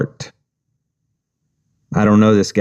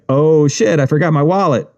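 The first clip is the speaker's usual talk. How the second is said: faked surprise at forgetting; fake realization; 7 semitones higher than usual. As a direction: act out forgetting the wallet. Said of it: "try to fake an".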